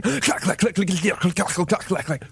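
typing noises. Acted. Imitating fast typing.

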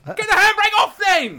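shout. Pretend. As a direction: yell it out high and loud. Shouting at football manager.

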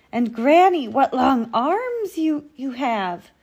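high, loud, drawn-out amazement; mounting girlish wonder; wondering little girl. A narrator's reading. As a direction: marvel like an astonished child. Girl astonished at changed Granny.

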